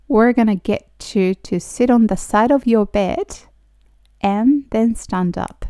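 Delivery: slow, careful teacher pronunciation; slow model reading. Shown as a demonstration. Teacher reading slowly for students to repeat. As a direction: spell each word out slowly for learners. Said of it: "私と一緒に発音してもらったら".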